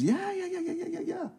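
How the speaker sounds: high, quick, soft string of dismissive ja; dismissive yeah yeah. Retold or imitated. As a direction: brush it off like you're not listening. Mock dismissive response to message.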